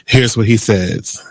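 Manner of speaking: ordinary talk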